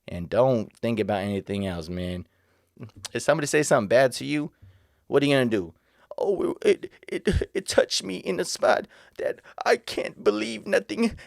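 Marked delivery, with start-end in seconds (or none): 6.2-11.2 high, slow mock-hurt whine; mock offended whiner. Mocking an oversensitive person, high slow whine. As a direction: lightly ham up someone wounded by a small insult.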